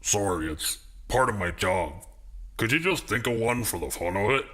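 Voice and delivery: apologetic tough guy, barely changed; gruff voice. Acted. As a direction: doorman asks for a fun password. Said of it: "The gruff voice called back".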